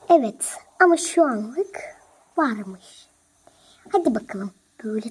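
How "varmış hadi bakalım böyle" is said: whisper. Acted. Setting trap, whispering.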